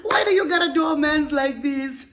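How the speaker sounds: high, loud wail through laughter; laugh-crying. Real, not acted. Host laugh-crying at the reader's story. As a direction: cry out while laughing.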